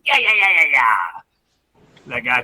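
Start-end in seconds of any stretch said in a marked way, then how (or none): 0.0-1.0 high, loud run of yapping barks; imitated hound barking. Storyteller imitating a female dog cutting in, rapid high barking. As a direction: yap like an excited female hound. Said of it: "this little female just came in there and".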